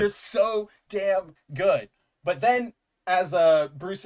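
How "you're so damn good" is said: slow, drawn-out awed praise; stretched-out dreamy admiration; slow gushing. Retold or imitated. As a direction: savour each word like a fan in awe. Gushing over the comic artist.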